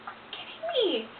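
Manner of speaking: high-pitched mock disbelief; exasperated squeak, retelling her own reaction; retold disbelief. Patient reacting to fentanyl delay, exasperated disbelief.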